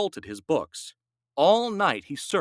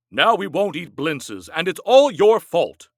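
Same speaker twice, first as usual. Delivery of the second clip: louder blaming complaint; accusing; 4 semitones higher, 6 dB louder than usual. A narrator's reading. Husband blaming his wife, angry complaint. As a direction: throw the blame at her. Quarrel over the blintzes.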